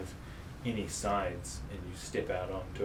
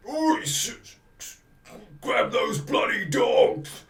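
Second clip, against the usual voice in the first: loud, slow, higher bellow; loud angry guard shout; guard yelling; 9 semitones higher, 9 dB louder, slower than usual. Acted. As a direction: bark it at the dogs. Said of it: "A guard yells out".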